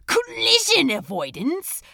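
laughing, mocking echo; incredulous laugh. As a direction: scoff at the very idea. Crowley mocks the feature.

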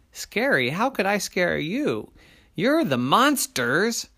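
high-pitched disbelief; incredulous little person. A narrator's reading. Little person answering frightened monsters, incredulous. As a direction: protest in a high little voice, astonished.

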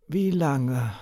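tight, pressed voice; strangled voice. Acted. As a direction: squeeze the words out. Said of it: "brachte sie mit gepresster Stimme hervor".

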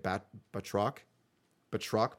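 feeling out "Batroc" syllable by syllable, as a question; unsure pronunciation. Real, not acted. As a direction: try "Batroc" tentatively, breaking off after "Bat-". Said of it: "how do you say it?".

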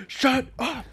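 high-pitched yelled shut up; yelling. Retold or imitated. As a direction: yell it like a kid fighting. Retelling a yelled argument scene.

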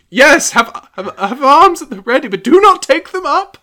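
high, loud, nervous pleading voice; timid plea. Acted. Giles timidly pleading with the crowd. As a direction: beg them not to take up arms.

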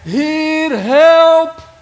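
high, drawn-out full-force shout; acted cry, loud and far above normal pitch; crying out for help. Blind beggar calling out to Jesus as he passes, desperate shout. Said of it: "roep je het uit".

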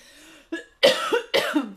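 harsh coughing fit from a swallowed chip; choking cough. Real, not acted. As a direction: cough hard while choking.

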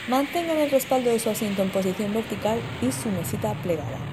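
soft, formal safety announcement; airport announcement. Pretend. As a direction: read the safety notice calmly. Cabin crew safety announcement. (anuncio de azafata)

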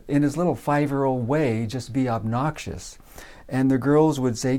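ordinary delivery

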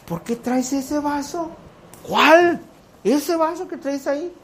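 high, slow scolding wife voice. Retold or imitated. Wife scolding her husband in the truck, high suspicious voice. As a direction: question him like a suspicious wife. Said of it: "Me dice".

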